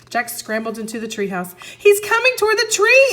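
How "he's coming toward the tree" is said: put-on much higher, louder panicked girl; a scared girl crying out; frightened cry. A narrator's reading. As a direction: cry out in alarm. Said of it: "Annie cried".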